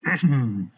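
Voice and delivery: a short, sharp, high clearing of the throat. A narrator's reading. Amanda clears her throat at Samantha, pointed throat clearing. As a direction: clear your throat pointedly before the punchline. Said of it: "makes back of throat clearing sound".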